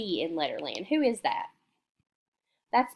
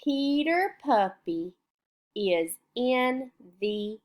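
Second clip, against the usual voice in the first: put-on slow, sing-song reading to children; slow read-aloud; much slower than usual. Shown as a demonstration. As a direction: read it slowly to young pupils. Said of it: "let's read this story".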